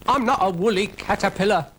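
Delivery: high, put-out snail voice; indignant little snail. Acted. Brian is mistaken for a caterpillar, high indignant voice. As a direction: correct her in a high little pipe.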